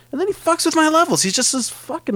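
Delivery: high, fast exasperated complaint; exasperated rant. Acted. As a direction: blurt the grievance out fast and high. Exasperated outburst.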